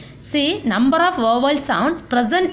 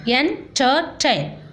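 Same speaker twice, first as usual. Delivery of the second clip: "entertain" split slowly into three separate syllables; teacher dividing "entertain" into syllables; much slower than usual. Shown as a demonstration. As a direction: say "entertain" in slow pieces, a gap between each. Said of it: "syllabi with the following words".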